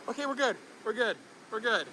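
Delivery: soft, settling reassurance; relieved. Real, not acted. Rider safe after the scare, soft relieved repetition. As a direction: say it softly, coming down from a scare.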